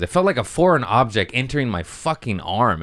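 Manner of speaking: ordinary delivery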